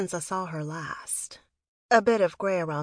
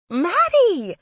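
high, loud squeal of a name; teenage girl's delighted shriek; excited scream; 12 semitones higher, 10 dB louder than usual. A narrator's reading. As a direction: scream her name like a thrilled little sister. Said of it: "Sarah screamed".

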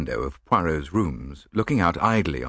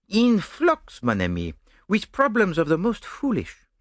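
vain Belgian detective, pleased with himself; Poirot explaining his own fame to Hastings; Poirot boasting; 11 semitones higher than usual. Acted.

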